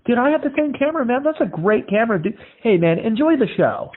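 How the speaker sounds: high, excited praise; Randy bonding over the camera, high and excited; friendly rocker. Retold or imitated. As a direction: gush about sharing the same camera.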